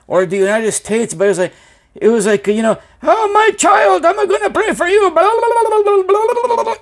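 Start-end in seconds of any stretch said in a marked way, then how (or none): 3.0-5.1 imitating a syrupy phone preacher; mock preacher calling him my child; prayer line preacher. 5.1-6.8 high babbling in tongues, laughing; imitating a preacher babbling in tongues; lightly hammed-up tongues babble; mock speaking in tongues. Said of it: "praying in the tongues".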